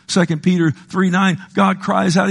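normal talking voice

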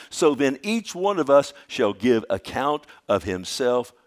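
loud, ringing preacher's proclamation of the verse; preaching scripture. Acted. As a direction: proclaim each Lord line to the back pew.

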